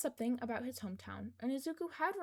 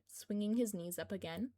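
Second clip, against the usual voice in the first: usual speaking voice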